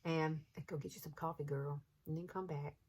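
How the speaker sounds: usual speaking voice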